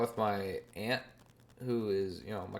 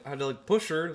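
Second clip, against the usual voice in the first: normal talking voice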